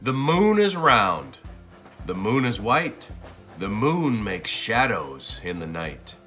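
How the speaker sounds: ordinary delivery, nothing marked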